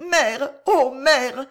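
slow, broken sobbing cry of mère; a daughter clinging to her mother, stammering through sobs. A narrator's reading. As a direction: choke out the words through tears. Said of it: "bégaya Loïse".

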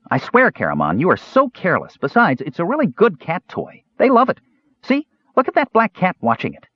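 put-on excited kid showing off his finds; eager Kender. Earwig showing the twins his treasures, high eager chatter.

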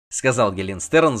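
ordinary delivery

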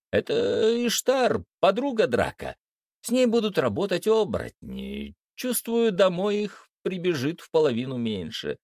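high-pitched gloating witch. A narrator's reading. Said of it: "злорадно сказала ведьма". (злорадно, высоким голосом)

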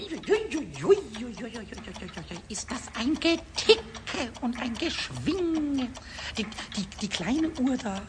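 ordinary delivery

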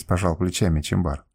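ordinary delivery, nothing marked

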